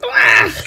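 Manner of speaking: loud, low gag of disgust; disgusted retch. A narrator's reading. Hen slurping buttermilk with held nose, disgusted. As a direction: gag on a horrid drink.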